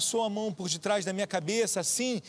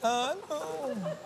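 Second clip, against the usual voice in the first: fake crying; 5 semitones higher, much slower than usual. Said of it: "Foi falso esse choro".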